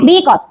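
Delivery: Because with the first syllable stressed; Because, wrongly stressed at the start. Shown as a demonstration. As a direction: lean hard on the Be of Because. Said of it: "B पे लगाते हैं आप".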